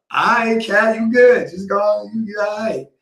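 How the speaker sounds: high-pitched quote to a cat; acted talk to cat. Retelling talking to the cat.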